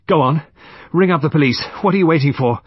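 loud, high, fierce demand. A narrator's reading. Murderess demands that the police be called, fiercely. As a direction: order them sharply and impatiently. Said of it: "she added fiercely".

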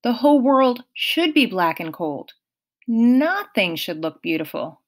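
ordinary delivery, nothing marked

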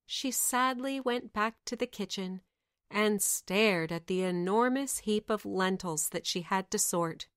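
plain reading voice

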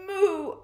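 high cow moo in uproar. A narrator's reading. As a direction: moo high amid a din. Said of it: "let out a deafening sound".

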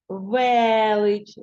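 Велич with its first vowel stretched out; stress on Е. Shown as a demonstration. Teacher showing how Е becomes stressed, Велич with a drawn-out Е. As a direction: lean long on the Е in Велич. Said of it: "ненаголошене е стане наголошеним".

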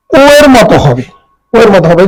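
ordinary delivery

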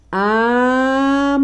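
drawn-out first syllable for writing; syllable dictation. Shown as a demonstration. Teacher dictating to pupils, syllable drawn out. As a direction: stretch the syllable so children can write it.

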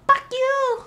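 high-pitched imitation of mom's accent, F turned into P. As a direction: mimic mom cursing in her accent, "Puck you". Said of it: "she has her accent".

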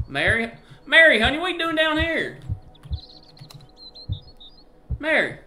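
loud, very high call to the wife. Pretend. Husband finding Mary down in the basement, calling out. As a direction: holler for her in a high, carrying voice.

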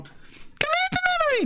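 very high, squeaky catchphrase; character voice-line imitation. Gamer retrying a character's line, very high imitation. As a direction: pipe it out far above your voice.

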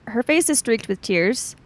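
usual speaking voice

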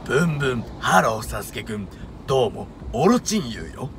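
low creepy villain voice with edge; lightly voiced sinister snake villain; Orochimaru impression. Shown as a demonstration. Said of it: "みんな一緒にオロチマルで". (オロチマルの声真似)

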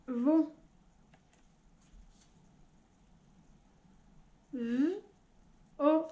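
sounds split one at a time; robot voice. Shown as a demonstration. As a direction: chop each word into sounds. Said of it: "dire gant en robot". (voix de robot qui découpe les sons)

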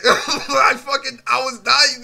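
slow, loud words broken by laughter; laughing hard. Real, not acted. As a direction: laugh through it.

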